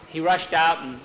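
plain reading voice

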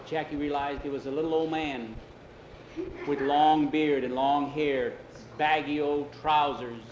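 ordinary delivery

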